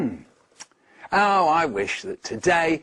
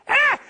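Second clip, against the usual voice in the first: a piercing, very high loud shriek; 6 dB louder than usual. Acted. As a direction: shriek as it vanishes. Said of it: "With a shriek".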